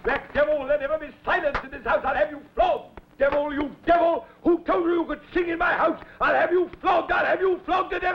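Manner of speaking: high, shouting rage, fast; furious tantrum. Acted. Potiphar shouting threats at Joseph in a tantrum. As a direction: threaten him at the top of your voice. Master rages at the slave.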